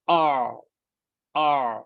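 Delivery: long, held "آ" squeezed from mid-throat; sustained "آ" with the middle of the throat constricted; ain sound. Shown as a demonstration. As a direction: say "آ" as a drawn-out Arabic ain, throat narrowed. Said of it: "سو تھوڑا سا practice کریں".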